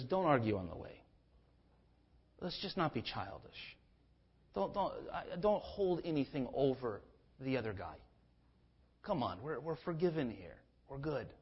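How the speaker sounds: quiet, soothing big-brother voice; gentle reassurance. Retold or imitated. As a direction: hush them kindly like a big brother. Joseph gently settling his brothers.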